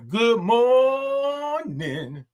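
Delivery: high, long-held morning call; drawn-out greeting. Real, not acted. Host greets viewers from Jacksonville, drawn out high. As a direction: hold the O on a high note. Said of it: "I love all those O's and R's".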